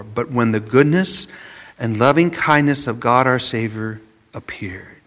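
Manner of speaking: hushed whisper, barely voiced; whispered scripture. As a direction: breathe the verse out like a secret. Reading the Titus verse, hushed.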